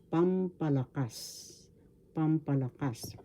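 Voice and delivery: soft review of pampalakas, accent on final KAS; stress on fourth syllable. Shown as a demonstration. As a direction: say pampalakas softly, landing on the last syllable. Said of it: "The accent is in the fourth syllable".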